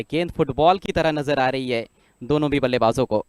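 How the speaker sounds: ordinary talk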